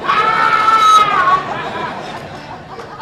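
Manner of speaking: long, high, very loud scream; piercing mock-terrified shriek, held long; girlish shriek. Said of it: "shriek like a girl".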